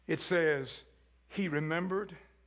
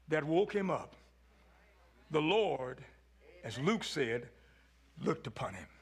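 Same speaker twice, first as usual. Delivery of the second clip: ordinary delivery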